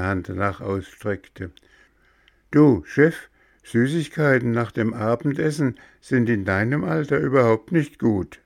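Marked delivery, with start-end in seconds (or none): 2.5-8.4 higher, louder, fast cheeky voice; cheeky assistant. Acted. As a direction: scold the boss quickly and loudly. Dominik warns the boss. (frecher Gehilfe, höher und lauter)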